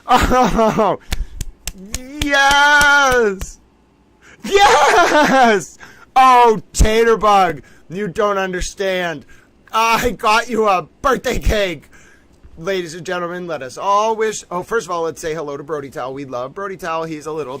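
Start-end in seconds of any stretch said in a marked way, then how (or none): 0.1-11.9 higher, louder, drawn-out cries of joy; ecstatic shout. Real, not acted. Streamer shouting to chat about the birthday cake, ecstatic. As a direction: whoop it up, thrilled to bits.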